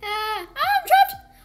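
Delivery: slow, high, loud scream with laughter and a sob; half-laughing scream. Real, not acted. Trapped in the game.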